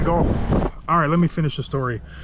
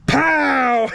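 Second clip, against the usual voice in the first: much higher shouted pow; kid's punch shout; 11 semitones higher, 4 dB louder than usual. Retold or imitated. Six-year-old shouts as he punches Daniel, high and loud. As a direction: yell it like a small kid throwing a punch. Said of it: "and he goes".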